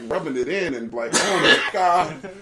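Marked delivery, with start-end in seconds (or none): 1.1-2.3 mock porn moan.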